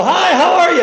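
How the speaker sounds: high, loud friendly greeting; cheerful hello. Retold or imitated. Preacher calling to a passing car, loud cheerful greeting. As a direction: call out a bright hi.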